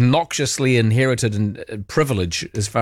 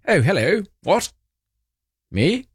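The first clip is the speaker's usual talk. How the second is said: imitating the king's surprise, pitch rising higher through Oh, hello, what? Me?; a hammed-up startled royal, lightly voiced; mock surprised king; 8 semitones higher than usual. Host mimics King Charles in a photo, mock surprised. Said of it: "You can tell he's thinking".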